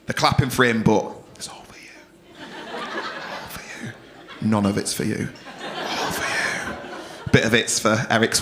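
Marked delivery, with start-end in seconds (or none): 1.3-1.9 soft, whispered secret aside; hushed mock-intimate reassurance; confiding whisper. Secret aside to audience member. 2.7-3.9 very slow, soft whisper; drawn-out, hushed mock-intimate aside; confiding whisper. Secret aside to audience member. 5.6-6.8 very slow, soft whisper; drawn-out, hushed mock-intimate aside; confiding whisper. Secret aside to audience member.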